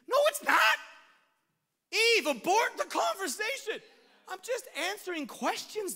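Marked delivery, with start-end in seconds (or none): 0.1-0.8 shouted, pitched very high; loud emphatic denial. Real, not acted. Preacher to the congregation, shouting the rebuttal. 1.9-3.8 laughing urgent warning. Real, not acted.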